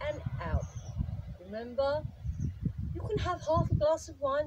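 loud, high, dramatic coaching; hammy excited instructions; over-the-top instructor. Acted. Exaggerated tricky exercise instruction.

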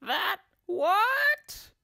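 very high, horrified shriek; screamed. A narrator's reading. Wife hears of giant skillywigger, horrified scream. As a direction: screech it in disbelief. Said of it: "screamed Missus Twit".

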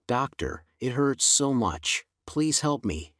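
plain reading voice

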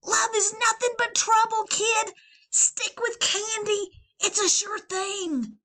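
high, loud and cocky; scoffing Valentine. Acted. Valentine to Leon, high and cocky. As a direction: laugh off love, crave candy.

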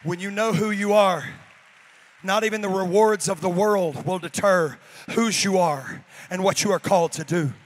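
a loud preaching declaration. Acted. Pastor proclaiming the refrain to the congregation. Sermon refrain, shouted back.

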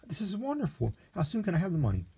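high, eager and breathless with joy; the youth gushing over his fortune; giddy delight. Acted. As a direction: gush like a kid given treats. Lexington thrilled by inheritance.

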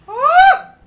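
very high, very loud panicked scream; terrified screaming. Real, not acted. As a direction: shriek for your life. Chased by monster, screaming.

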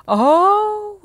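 long, high, loud "oh"; amazed cry. A narrator's reading. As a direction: cry out like a whole astonished crowd. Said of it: "riep iedereen op het plein".

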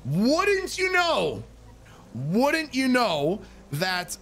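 drawn out slowly, high start; sarcastic slow build. Real, not acted. Telling viewers who got kicked off, slow sarcastic build. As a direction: stretch it for comic suspense.

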